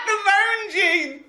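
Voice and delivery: high, loud laughing squeal; cracking up while repeating it; real helpless laughter. Real, not acted. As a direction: shriek it through laughter, drawn out. Cracking up at friend's theory.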